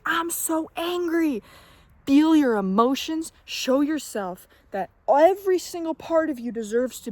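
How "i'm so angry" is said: higher, slow mock angry outburst; letting anger out. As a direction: let the anger out, drawn out. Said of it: "Just, like, be like".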